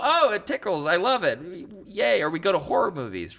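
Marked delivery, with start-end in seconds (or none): 0.0-2.2 high, loud gleeful squeal; mock giddy delight. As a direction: gush it like a thrilled kid. Voicing thrill-seekers' delight.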